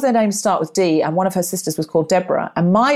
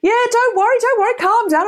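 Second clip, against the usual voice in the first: high, breezy don't-worry voice; mock soothing investigator. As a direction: brush off her panic lightly. Imitating investigator calming her.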